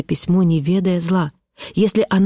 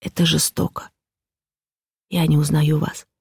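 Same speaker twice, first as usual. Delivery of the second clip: ordinary delivery, nothing marked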